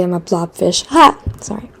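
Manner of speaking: normal talking voice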